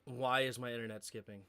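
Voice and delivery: low, hushed exasperation; mad, beyond upset. Real, not acted. Streamer upset at skipping internet, quietly mad. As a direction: keep the anger low and very quiet. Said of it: "I'm so mad".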